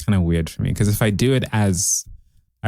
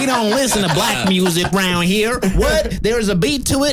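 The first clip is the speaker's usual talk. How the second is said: high hammed-up bigot voice, laughing; 9 semitones higher than usual. Acting a racist Klan member.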